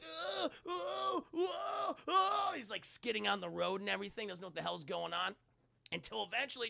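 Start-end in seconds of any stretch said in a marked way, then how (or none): 0.0-2.5 high, frantic repeated oh cries; panicked yelps. Acted. Driver skidding on the oil, frantic high yelps. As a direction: squeal in rising panic.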